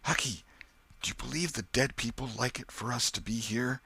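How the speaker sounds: put-on high boyish Tom, hushed and nervous; scared boy asking in a hushed voice; whispered question. A narrator's reading. Tom asks Huck in the graveyard, hushed and uneasy. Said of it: "so he said in a whisper".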